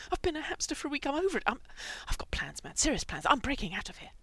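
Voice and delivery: loud, high, agitated insistence; manic declaration. A narrator's reading. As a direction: rant as if desperate to break out. Manic hamster declares escape plan.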